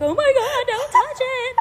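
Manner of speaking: high, loud panicked cry; panicked shriek. Retold or imitated. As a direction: shriek it like the spider scares you. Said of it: "people were like".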